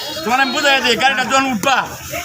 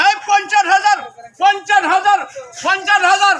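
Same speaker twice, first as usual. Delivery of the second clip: auctioneer; 5 semitones higher than usual. Acted. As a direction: call out the bids like an auctioneer. Auctioneer repeats bid.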